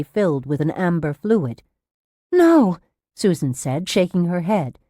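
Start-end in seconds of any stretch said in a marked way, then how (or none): 2.3-2.8 high, loud no; sharp, frightened objection; alarmed refusal. A narrator's reading. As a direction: refuse in panic. Patient refusing the injection.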